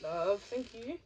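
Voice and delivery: ordinary delivery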